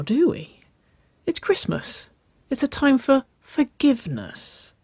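coy, sweet coaxing with high lilts; honeyed voice. A narrator's reading. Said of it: "She honeyed her voice".

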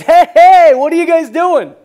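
high, very loud hail; yelling out. Acted. Preacher as Jesus on the beach hailing the fishermen, yelling. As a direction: holler across the water to a boat. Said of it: "he yells out".

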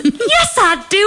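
very high, hammed-up insistence; imitated insistent patient. Patient arguing with his nurse about loving her, high mock insistence. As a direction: protest high like the besotted patient. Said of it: "And he goes".

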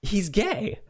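high, squeaky sudden realization; hammed-up surprised gasp of insight; mock realization. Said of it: "it's just like".

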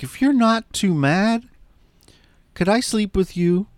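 put-on a child's timid ask after crying; mimicking a crying kid. Host voicing the crying boy asking his mom, small and pleading. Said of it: "he's crying and he's like".

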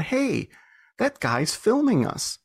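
high-pitched, drawn-out mock call; retold calling out. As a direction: call over to him, stretching the words high. Calls over to the man filming.

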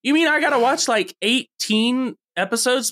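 high-pitched mock outrage; incredulous. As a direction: protest the huge commitment in disbelief. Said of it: "And I'm like".

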